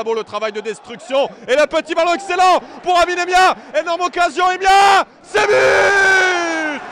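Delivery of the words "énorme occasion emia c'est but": rising shout into the goal call; excited sports commentary. Real, not acted. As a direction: build up and explode on the goal.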